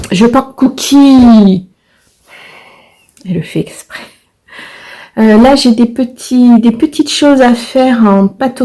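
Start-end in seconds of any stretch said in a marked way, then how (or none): 3.3-4.0 whispered aside. Real, not acted. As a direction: whisper it under your breath. Aside about the cat. (chuchoté)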